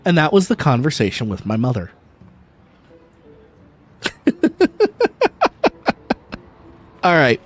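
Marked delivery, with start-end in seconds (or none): 4.0-6.4 high laugh. Real, not acted. Laugh after story.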